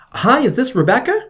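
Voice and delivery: high-pitched, energetic phone opener; high energy. Shown as a demonstration. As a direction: lift the pitch into an eager question. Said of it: "So you use high energy".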